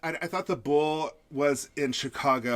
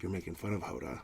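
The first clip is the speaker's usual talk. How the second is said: low menacing Hoda; 9 semitones lower, 12 dB quieter, faster than usual. Pretend. Hoda speaks in third person.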